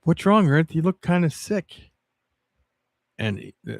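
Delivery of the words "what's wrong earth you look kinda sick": slightly higher, lightly voiced cartoon moon; a hint of a character voice, pitched up; concerned cartoon moon. Moon asking Earth what's wrong, lightly acted concern. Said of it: "the moon was looking at the Earth and said".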